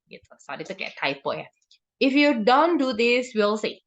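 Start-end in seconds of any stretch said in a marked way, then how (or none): 2.0-3.8 slow warning, starting higher; mock threatening. Punisher warning a child to comply, acted slow threat. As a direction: drag out the condition like a threat. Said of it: "terdengarnya mengancam".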